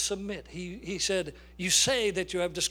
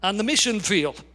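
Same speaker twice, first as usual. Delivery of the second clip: full-force preaching; 4 dB louder than usual. Acted. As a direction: hammer the story home to the congregation. Preacher builds to climax.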